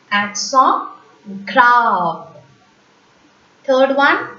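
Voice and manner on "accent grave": "Accent grave" repeated as ak-SON grahv; French pronunciation. Shown as a demonstration. Teacher repeats the second accent for learners, French "Accent grave". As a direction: say "grave" with open French ah, falling. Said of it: "The second accent".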